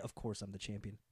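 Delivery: quiet, smug little boast; smug champion. Retold or imitated. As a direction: mutter it like a cocky champ. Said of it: "turn him a little bit to be like".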